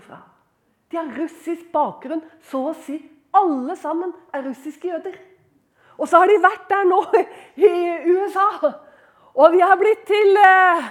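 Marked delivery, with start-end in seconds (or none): 6.0-10.9 loud, high, driving delivery; fervent preaching. Acted. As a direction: push it out forcefully to the congregation. Preaches at full force.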